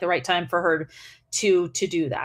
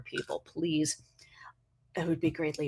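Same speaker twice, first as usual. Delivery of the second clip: whisper; 5 semitones lower, 10 dB quieter than usual. Real, not acted. Joking aside to viewers.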